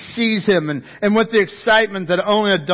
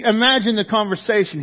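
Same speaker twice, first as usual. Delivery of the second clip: ordinary talk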